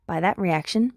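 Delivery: plain reading voice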